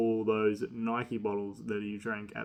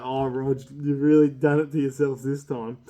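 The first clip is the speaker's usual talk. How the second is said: louder, gleeful taunting call; loud teasing; 8 dB louder than usual. Retold or imitated. Boys at the back teasing squirming Rog, loud and gleeful. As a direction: rub it in loudly, enjoying his misery.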